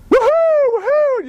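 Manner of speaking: very high, drawn-out whooping; celebratory yelling. Acted. Neighbor running round the yard at dawn, high ecstatic whooping. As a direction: whoop in long high cries. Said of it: "running around the yard yelling".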